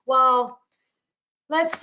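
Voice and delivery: ordinary talk, nothing marked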